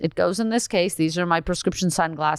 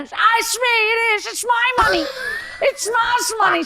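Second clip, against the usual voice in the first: mother shouting; 13 semitones higher than usual. Retold or imitated. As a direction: yell it out like a frantic mom caught in the act. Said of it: "She's like".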